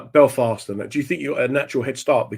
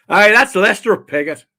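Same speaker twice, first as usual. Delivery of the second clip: put-on loud, high Northern Irish accent; Northern Irish cab driver; 8 semitones higher, 7 dB louder than usual. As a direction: proclaim it as a local cabbie. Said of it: "looks at him and goes".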